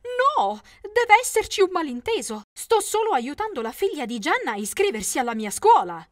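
a woman hurrying to clear up a misunderstanding; quick explanation. Acted. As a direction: rush to explain, voiced as another woman. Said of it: "spiegò rapidamente".

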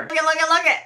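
high, rapid, eager burst; excited chatter. Real, not acted. Vlogger opening the new box, high and excited.

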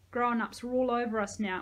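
plain reading voice

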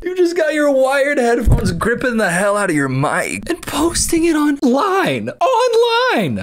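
high-pitched laughing words; laughing so hard the voice climbs; laughing in disbelief. Real, not acted. Mocking the creator's video, laughing high and hard.

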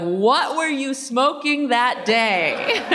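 high, drawn-out question through laughter; stretched-out, teasing disbelief; mock incredulous. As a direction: laugh as you ask it in disbelief.

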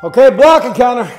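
loud, sharp coach call over music; shouted command. Real, not acted. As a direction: call it out to the class. Coach calling out boxing commands.

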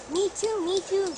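excited pony, a touch of whinny. A narrator's reading. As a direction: squeal it like a happy pony. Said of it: "she whinnied".

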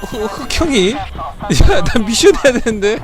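high, loud indignant shouting at the NPC; furious streamer yelling at the screen; angry yelling. Real, not acted. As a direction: yell in outrage at being snitched on. Reported to police in game, furious.

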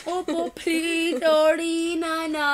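put-on slow, drawn-out baby-talk apology; stretched-out, put-on sorry; mock pleading. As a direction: beg playfully for forgiveness. Playful begging apology to baby.

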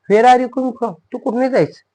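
quick, high-pitched questioning; acted question. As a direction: call it out as to a running child. Acted question to a running child. (उंच स्वरात पटकन विचारणे)

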